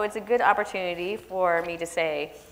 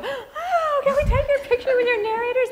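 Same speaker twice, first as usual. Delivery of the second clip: high, squealing, laughing fangirl gush; hammed-up giddy librarian voice; 6 dB louder than usual. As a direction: mimic excited librarians begging for a photo.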